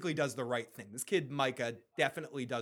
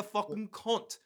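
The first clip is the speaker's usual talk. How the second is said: put-on British accent, higher pitch; horrible British accent; 6 semitones higher, slower than usual. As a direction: swear in a bad British accent. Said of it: "Sorry for the horrible British accent".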